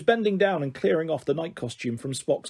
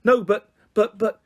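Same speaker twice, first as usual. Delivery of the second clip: higher, louder stammered protest; near tears; 5 semitones higher, 5 dB louder than usual. A narrator's reading. Sam protests to his sister, mildly tearful. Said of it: "on the brink of tears".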